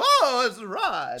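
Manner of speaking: high, slow, prim lady voice; man doing a woman's voice. Retold or imitated. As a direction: stretch it out like a fussy mom. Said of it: "that's the impression of my mom".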